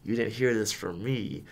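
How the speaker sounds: ordinary talk, nothing marked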